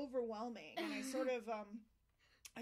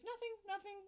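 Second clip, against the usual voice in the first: high-pitched, brushing-off reply; quick dismissal; 10 semitones higher than usual. Retold or imitated. Technician to worried patient on the ultrasound table, high dismissal. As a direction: imitate a technician deflecting worry.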